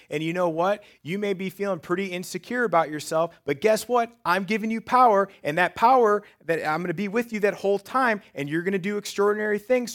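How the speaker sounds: high, loud, driving sermon voice; full-force preaching. Acted. Preacher rallying insecure listeners, loud and high. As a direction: preach it out to the congregation.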